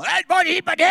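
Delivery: loud high mock shout of buddy; mock Glasgow shout. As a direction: bellow it like a rowdy Glaswegian. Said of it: "they come".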